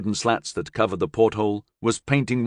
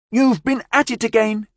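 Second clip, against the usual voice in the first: high, teasing squeal; excited child; 4 dB louder than usual. A narrator's reading.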